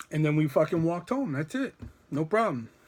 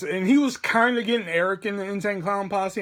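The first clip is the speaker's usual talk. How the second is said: ordinary delivery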